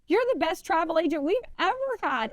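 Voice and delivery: high, insistent retold protest; imitated earnest protest. Client begging to keep his travel agent, imitated protest.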